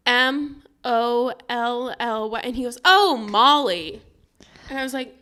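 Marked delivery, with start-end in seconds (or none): 0.1-2.4 letter by letter, evenly spaced; slow spelling out. Retold or imitated. Customer spelling a name for a barista, slow and deliberate. 2.8-4.0 loud, drawn-out recognition; retold dawning realization. Imitating barista finally getting the name, loud drawn-out realization.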